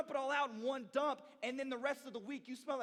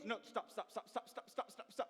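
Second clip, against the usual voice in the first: 1 imitating brother; 6 dB quieter than usual. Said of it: "We're imitating my brother".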